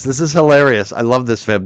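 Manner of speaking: ordinary delivery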